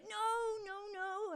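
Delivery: high-pitched mock denial; mock protest. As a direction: squeak out a fake protest. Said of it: "And I'm like".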